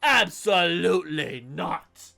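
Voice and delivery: theatrical critic reading with mounting outrage; mock dramatic review reading. Podcaster reading a viewer's harsh review, theatrical. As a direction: read the scathing review like a pompous critic.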